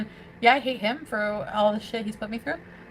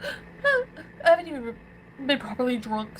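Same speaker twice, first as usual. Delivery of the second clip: crying; slower than usual. Real, not acted. Defending her drinking, breaking into tears. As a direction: gasp and cry as you start. Drunk, upset about alcohol.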